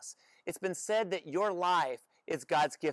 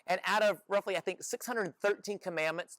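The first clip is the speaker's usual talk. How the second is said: usual speaking voice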